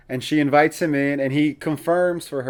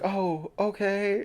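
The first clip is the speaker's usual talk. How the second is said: higher, small, slow and soft; embarrassed; 7 semitones higher, 6 dB quieter, much slower than usual. Retold or imitated. Girl realising the crush never read her diary, embarrassed and soft. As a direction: shrink with embarrassment. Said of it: "so embarrassed".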